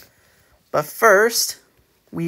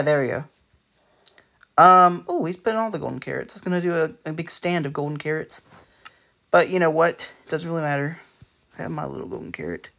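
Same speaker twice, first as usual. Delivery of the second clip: ordinary talk, nothing marked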